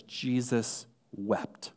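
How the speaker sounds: drawn-out, hushed reading; slow and soft. Acted. Preacher reads the shortest verse slowly and softly. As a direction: linger on each word quietly.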